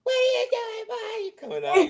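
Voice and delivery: high-pitched imitation of mom; mom's voice. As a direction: play the worried mom, high and shrill-free.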